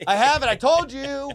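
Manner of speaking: high, loud acted insistence; worked-up vindication. Angler proving to officers he has a license, worked-up insistence. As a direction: throw it triumphantly in their faces. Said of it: "got to the point where I was like".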